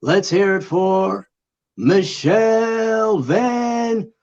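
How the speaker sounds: slow, drawn-out ring announcer; boxer announcer voice. Pretend. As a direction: stretch the name out big for the crowd. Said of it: "boxer announcer voice".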